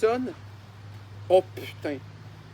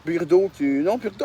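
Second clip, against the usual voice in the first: usual speaking voice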